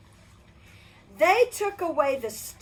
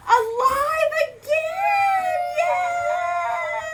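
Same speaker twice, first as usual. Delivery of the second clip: excited; 6 dB louder, much slower than usual. Acted. Joyful reunion in the story.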